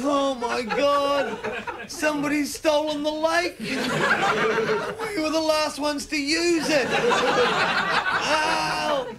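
high, slow, laughing alarm; slow mock panic. Tripping mates waking to a vanished lake, high slow panic. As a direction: wail about the stolen lake. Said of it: "we got up just going".

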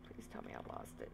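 scared whisper. Real, not acted. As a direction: breathe it out unvoiced. Hiding from the monster.

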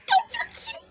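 high, laughing squeal of delight; giggling squeal. Real, not acted. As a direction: giggle through it, overwhelmed. Gushing over cute couple.

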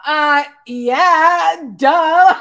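loud, high, laughing; laughing shout. Real, not acted. Laughing at own joke.

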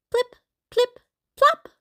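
high, popping plip-plop; dropping treats. A narrator's reading. Treats drop into bags, high popping sounds.

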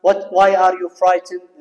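slow and loud, word by word; slow, emphatic class reading; slow model reading. Shown as a demonstration. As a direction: spell the question out slowly. Teacher models English question slowly.

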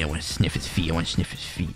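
low, very quiet creepy chant; creepy muttering. Pretend. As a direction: mutter it low under your breath. Creepy muttered chant while chasing an enemy.

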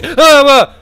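sudden high scream at a jump scare; startled scream. Real, not acted. Gamer jolted by jump scare, screaming. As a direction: shriek as the animatronic lunges.

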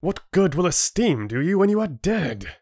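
high-pitched, heated challenge; angry growl. A narrator's reading. Rin challenging the complacent chieftain, angry. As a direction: demand it in exasperated anger. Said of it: "Rin growled".

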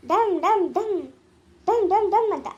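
playful drum-like beating noises; rhythmic mock thumping for the child; beating sound. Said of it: "ಹೆಂಗೇಳ?". (ಮಗುವಿಗೆ ಆಟದ ಡಮ್ ಡಮ್ ಶಬ್ದ)

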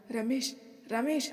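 anxious call. A narrator's reading. Manohar to collapsing Ramesh, calling out anxiously. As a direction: call his name twice in alarm. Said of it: "Manohar కంగారుగా".

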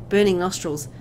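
ordinary talk, nothing marked

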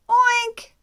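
very high, very loud oink; one commanding pig oink; mommy pig oink. Acted. Mommy pig calling piglets, very loud high oink. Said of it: "the mommy says".

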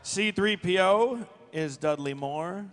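ordinary delivery

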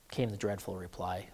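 ordinary delivery

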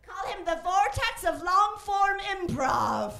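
loud, slow, drawn-out proclamation; big showy introduction; grandiose announcement. Acted. Introducing a friend's grand alias.

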